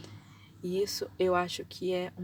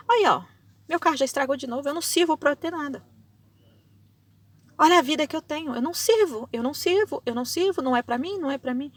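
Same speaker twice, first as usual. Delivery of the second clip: high, loud wail of "I'm no good"; a loud, high moan cursing her own life; despairing self-curse; 7 semitones higher, 8 dB louder, faster than usual. Retold or imitated. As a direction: wail it like someone who has given up on herself. Imitating self-cursing lament.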